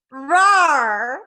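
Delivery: a weak playful leopard growl; leopard snarl. Pretend. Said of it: "It's the worst leopard I've ever heard".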